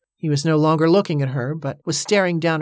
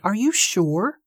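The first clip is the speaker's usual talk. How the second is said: higher, doubting question; protesting; 4 semitones higher, slower than usual. A narrator's reading. Danny questions his uncle's refusal, protesting. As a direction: press him to reconsider, pitch lifted. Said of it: "Danny protested".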